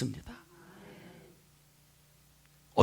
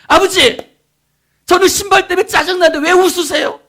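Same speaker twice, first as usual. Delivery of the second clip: high, loud mock outburst; annoyed child; 11 dB louder than usual. Boy complaining to his laughing dad, acted annoyance. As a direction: protest like a fed-up kid. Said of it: "아빠한테 막 짜증을 냈습니다".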